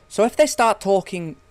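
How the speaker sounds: high, loud southern English accent demo. Shown as a demonstration. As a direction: show how far south they sound. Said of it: "you've probably gone a bit too far south".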